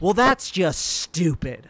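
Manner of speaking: sarcastic. Pretend. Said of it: "a very sarcastic tone".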